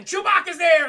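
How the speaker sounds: loud, high burst of excitement; shouted dramatic reveal; excited storytelling. Real, not acted. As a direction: punch the surprise entrance. Sudden attack in the retelling.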